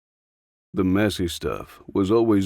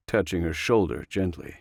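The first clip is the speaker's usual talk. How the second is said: ordinary delivery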